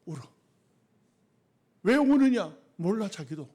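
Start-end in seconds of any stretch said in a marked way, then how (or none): none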